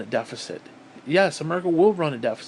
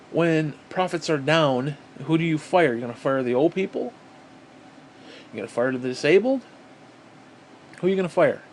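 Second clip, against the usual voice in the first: ordinary talk, nothing marked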